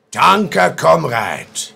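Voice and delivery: high, loud German medic. Pretend. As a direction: shout it like a field medic. Requested line in character.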